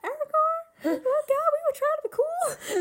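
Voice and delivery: hammed-up tearful whine at a friend; mock whining. Friend voicing embarrassed companions to Aragorn, mock whining. Acted embarrassed reply, played for laughs.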